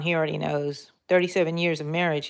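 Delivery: normal talking voice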